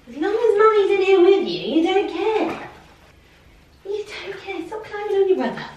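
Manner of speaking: high pet-talk voice. Real, not acted.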